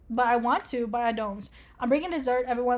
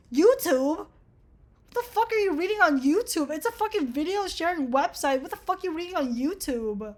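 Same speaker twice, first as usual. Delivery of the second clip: incredulous outrage; 5 semitones higher than usual. Real, not acted. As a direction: question it as if utterly appalled. Incredulous at an answer option.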